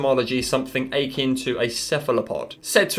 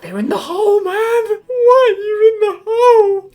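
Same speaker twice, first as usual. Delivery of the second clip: shrieking disbelief; 8 dB louder than usual. Real, not acted. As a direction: scream at the swimmers in horror. People swimming in blue hole.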